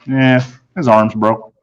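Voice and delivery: ordinary delivery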